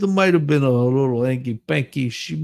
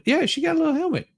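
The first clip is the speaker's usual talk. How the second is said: squeaky aside; 12 semitones higher, much faster than usual. Acted.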